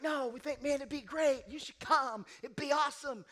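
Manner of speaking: high, loud enthusiastic pleading; hammed-up excited recruiters; eager urging. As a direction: pump him up to come. Church guys urging him to come.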